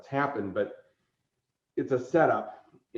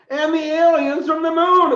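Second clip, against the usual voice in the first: high, loud, ringing announcer call; mock-grand herald's announcement; 9 dB louder than usual. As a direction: proclaim it like a herald. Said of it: "and like".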